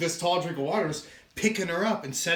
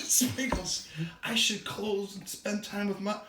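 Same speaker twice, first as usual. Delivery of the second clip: slightly higher, softer, confiding; lightly voiced bookseller; 4 semitones higher, 5 dB quieter than usual. Bookseller confiding in her cat, lightly voiced and wistful. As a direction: softly confide in a pet. Voicing Katie talking to cat.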